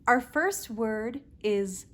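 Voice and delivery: ordinary delivery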